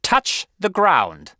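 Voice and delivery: high, loud, drawn-out command; slow, forceful headmaster; snapped order. A narrator's reading. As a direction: stretch each word into a stern order. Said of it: "Mister Coombs snapped out".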